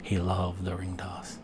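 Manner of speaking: usual speaking voice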